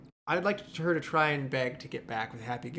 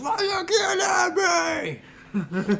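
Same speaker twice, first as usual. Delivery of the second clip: high, drawn-out crying shout; mock yell; 5 dB louder, much slower than usual. Pitching a rewrite, yelling at the ex-girlfriend mockingly. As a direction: yell it slowly in her face. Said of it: "yelling in her face".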